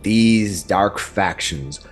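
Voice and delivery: grand fantasy narration over music; epic narration. Acted. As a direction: set the scene like a dark fantasy trailer. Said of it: "Did I oversell it?".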